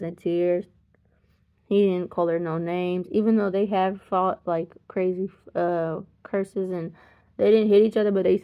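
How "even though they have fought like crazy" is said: ordinary talk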